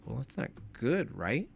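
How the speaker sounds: "good" and "right" each swung upward as questions; tentative question, rising on "good" and "right". Shown as a demonstration. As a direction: softly ask it, lifting "good, right?". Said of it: "you have to say it like a question".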